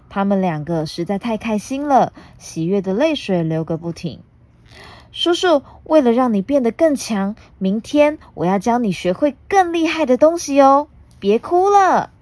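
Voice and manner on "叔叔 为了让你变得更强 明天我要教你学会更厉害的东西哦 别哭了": higher, gentle teasing voice; comforting child. Acted. Puna telling her uncle not to cry, gentle child voice. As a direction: cheer him up kindly.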